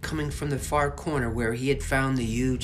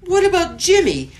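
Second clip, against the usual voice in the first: much higher, loud, disbelieving shout; outraged shout; 14 semitones higher, 10 dB louder than usual. Acted. Alfie outraged at witches.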